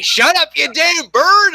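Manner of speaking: loud, high, drawn-out scolding; exasperated owner. Retold or imitated. Relative telling off the bird, exasperated yell. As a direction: yell it at a noisy pet. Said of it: "Then my relative who had it, though, would say".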